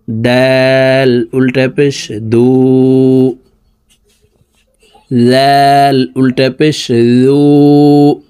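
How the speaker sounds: slow sing-song spelling chant; patient lesson chant, lines drawn out; drill recitation. Shown as a demonstration. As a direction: recite each letter for the class to echo. Teacher drills letter recitation.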